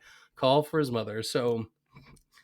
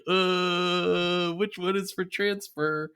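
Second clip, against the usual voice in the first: long, drawn-out dim "uh" of hesitation; lightly voiced clueless bafflement; puzzled Homer; 3 semitones higher, slower than usual. Homer staring at four phone buttons, mildly baffled. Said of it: "And he's like".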